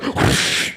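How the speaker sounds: exaggerated mouth-made whoosh; kung fu sound effect. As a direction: imitate an overdubbed movie swoosh. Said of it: "Same thing in the porno".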